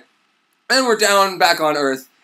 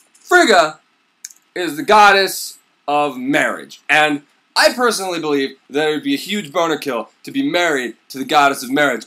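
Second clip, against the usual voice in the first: usual speaking voice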